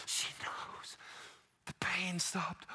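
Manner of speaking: hushed, breathy whisper, slowing down. Acted. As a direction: let it fall to an awed whisper. Awed hush at the healing.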